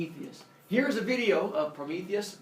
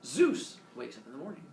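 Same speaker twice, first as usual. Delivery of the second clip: plain reading voice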